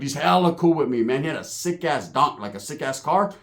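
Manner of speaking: usual speaking voice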